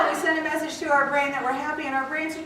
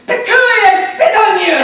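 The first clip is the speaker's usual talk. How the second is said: loud, high mock-French outburst; shouted high French-accented retort; 8 semitones higher, 14 dB louder than usual. Shown as a demonstration. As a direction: hurl it back in a French accent. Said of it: "with a French accent say".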